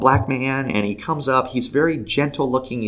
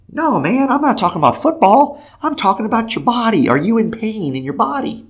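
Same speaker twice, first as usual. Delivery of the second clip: high, loud, urgent reassurance; insistent clarifying; 12 semitones higher, 5 dB louder than usual. Retold or imitated. Explaining to an offended old man, high and insistent. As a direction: clear it up in a raised, pleading pitch.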